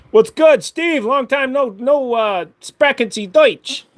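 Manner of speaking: high, loud, goofy dog voice, slowing at the end; dog's goofy voice. Pretend. Speaking for his dog, greeting Steve in a high silly voice. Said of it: "conserving his energy for when he has to have a".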